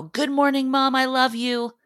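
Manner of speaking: high, bright mock child greeting; voicing a young son's high happy morning yell; excited kid yelling. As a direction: burst in like an excited little boy. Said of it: "burst into my room and yell".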